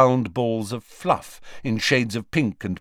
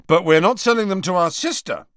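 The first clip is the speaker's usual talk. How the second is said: louder, higher, emphatic refusal; stern and raised; suddenly stern; 10 semitones higher, 6 dB louder than usual. A narrator's reading. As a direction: put your foot down as a protective brother. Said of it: "becoming suddenly stern".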